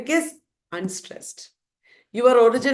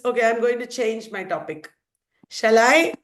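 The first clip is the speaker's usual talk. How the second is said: usual speaking voice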